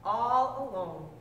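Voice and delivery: usual speaking voice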